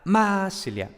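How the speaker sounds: "masilia" as MAAA-silia, opening syllable long and strong; "masilia" with an exaggerated initial accent; stress on first syllable. Shown as a demonstration. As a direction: punch and hold the MA of "masilia", toss off the rest. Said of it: "siempre estaba en la primera sílaba".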